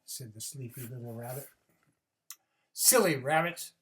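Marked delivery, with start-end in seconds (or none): 2.7-3.7 owl scolding. Acted. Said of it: "said the owl".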